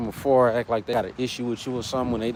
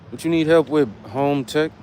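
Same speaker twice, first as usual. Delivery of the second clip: usual speaking voice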